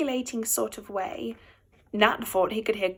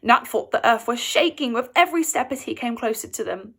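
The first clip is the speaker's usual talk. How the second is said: ordinary delivery, nothing marked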